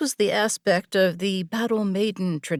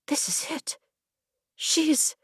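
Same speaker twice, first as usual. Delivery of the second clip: high, hushed breathless thrill; trembling awe; 9 semitones higher, 4 dB quieter than usual. A narrator's reading. Kiora trembling as the mare meets her gaze, breathless. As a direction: tremble with wonder.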